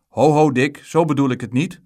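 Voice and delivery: high, forceful call of objection. A narrator's reading. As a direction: shout to stop the boy, alarmed. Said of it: "riep de bakker".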